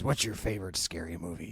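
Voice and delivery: low menacing phone voice; creepy killer voice. Retold or imitated. Quoting the killer's phone line.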